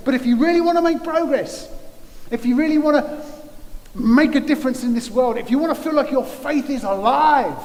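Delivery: rally the congregation to act; full-force preaching. Acted. Preacher urging Elim Church to act, rousing and loud.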